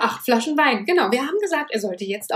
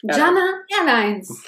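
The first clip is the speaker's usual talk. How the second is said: flight attendant; 4 semitones higher, slower than usual. Pretend. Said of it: "ich mach die Stewardess".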